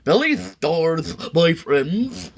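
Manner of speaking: high, slow wheedling huckster voice; sly salesman. Acted. McBean luring the sneetches back, high sly salesman voice. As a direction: wink through the pitch.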